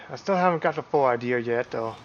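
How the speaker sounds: normal talking voice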